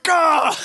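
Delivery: loud, high exasperated gah; sudden yelp of defeat; frustrated gah. Retold or imitated. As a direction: blurt out a kid's frustrated gah. Kid's plan fails.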